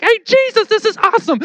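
very high, giddy imitation; squeal-pitched playful delight, acted out; excited greeting. Acts Mary meeting Jesus.

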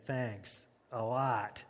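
slow, dragged-out sarcastic thanks. Shown as a demonstration. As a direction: stretch it out with resentment. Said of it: "don't appreciate that".

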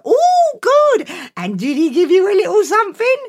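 high, loud eager mother; sweetly expectant mum. Acted.

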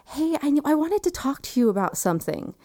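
high-pitched distant call; far-away voice. Retold or imitated. Anesthesiologist to fading patient, far-away high voice. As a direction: call lightly, as from a long way off. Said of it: "it seems very far away".

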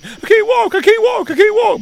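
imitating a kid yelling he can't walk; mock yelling. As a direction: yell it like a hurt boy. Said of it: "he's yelling".

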